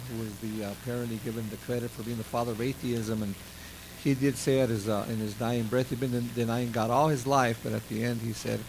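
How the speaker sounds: normal talking voice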